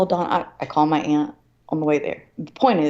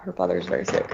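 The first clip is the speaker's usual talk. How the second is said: repeated much lower and softer for weight; lower, quieter repeat; 12 semitones lower, 5 dB quieter, faster than usual. Shown as a demonstration. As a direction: say it again low, letting it sink in. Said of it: "I will say that".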